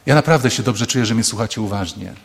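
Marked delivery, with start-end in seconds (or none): none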